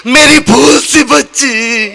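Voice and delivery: slow, drawn-out lament; hammed-up tearful farewell; mock father's wail. Bride's father wailing after his daughter.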